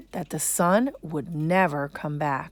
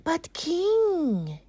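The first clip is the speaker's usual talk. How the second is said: python voice; 11 semitones higher than usual. Acted. Python begins to plead.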